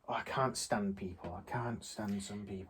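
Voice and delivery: low-voiced grumbling complaint about people; muttering. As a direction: grumble it half to yourself. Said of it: "muttering under your breath".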